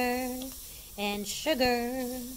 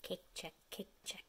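whisper; 6 semitones lower, 17 dB quieter, faster than usual. Real, not acted. Whispered check.

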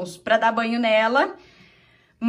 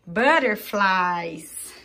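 slow teaching; 4 semitones lower, much slower than usual. Shown as a demonstration. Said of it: "models the word slowly".

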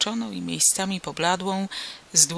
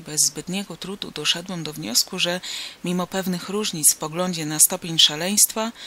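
ordinary delivery, nothing marked

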